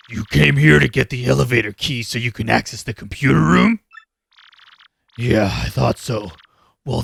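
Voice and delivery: low growling villain, menacing; low gravel vigilante growl. Acted. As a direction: growl out a threat, slow and low. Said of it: "I'm gonna do a less gravelly voice".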